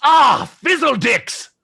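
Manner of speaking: loud, high burst of frustration; frustrated mock curse. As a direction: curse your clumsy fall out loud. Player curses after failed jump.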